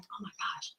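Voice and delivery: barely voiced, hushed realization; awed whisper. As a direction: whisper it in sudden awe. Retells her awed realization.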